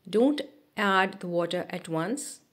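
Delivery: ordinary talk, nothing marked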